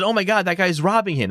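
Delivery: high imitating yell of a bystander; mock NPC yell. Quoting the NPC to the podcast hosts, high mock yell. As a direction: call it out like a startled villager. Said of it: "That's what he yelled".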